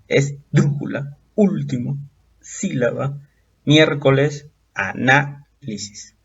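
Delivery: "Esdrújula, último, sílaba, miércoles, análisis" slowly, drawing out DRÚ, ÚL, SÍ, MIÉR, NÁ; stress on antepenultimate syllable. Shown as a demonstration. Teacher lists examples of esdrújulas, antepenultimate stress. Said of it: "presentan acento en la antepenúltima sílaba".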